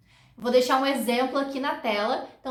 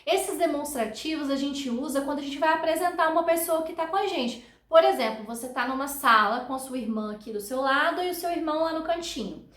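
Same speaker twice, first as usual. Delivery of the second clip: ordinary delivery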